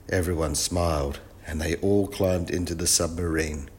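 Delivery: usual speaking voice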